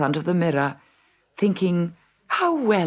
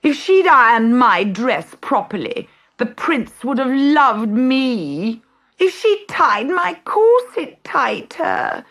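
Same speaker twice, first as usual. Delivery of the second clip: grizzling; 10 semitones higher, 4 dB louder than usual. A narrator's reading. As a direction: moan like a sulky stepsister. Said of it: "grizzled Gertrude".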